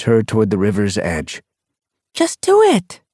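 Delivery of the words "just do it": high, loud push; urging. A narrator's reading. Sun Paw pushes her friend, high and loud. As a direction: shove her into it.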